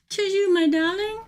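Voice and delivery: theatrical high "'Tis you, my darling."; hammed-up greeting. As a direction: greet her like a grand dame. Repeated greeting, higher.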